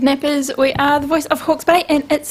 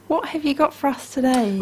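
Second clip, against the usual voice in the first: ordinary talk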